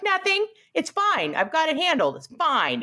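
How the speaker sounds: high, brittle mock reassurance; nervous Wanda. Wanda brushing off Vision's worry, high nervous voice. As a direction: cover it up, too brightly. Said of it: "She's like".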